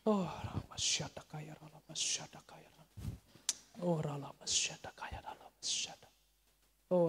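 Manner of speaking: breath-heavy, half-whispered repeated chant; praying in tongues. Real, not acted. Preacher leading congregation to God, praying in tongues. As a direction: pray rhythmically in repeated syllables, partly whispered.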